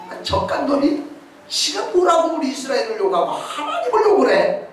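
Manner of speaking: high, heated, half-laughing anger; 높은 목소리로 분개하며 터뜨리는 말; indignant outburst. Acted. Young David bursts out indignant. (다윗이 골리앗의 모욕에 분노하여 외친다)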